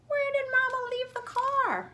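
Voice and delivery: wondering aloud, lost in the lot; puzzled question. A narrator's reading. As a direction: reader asking where the car went, high and puzzled.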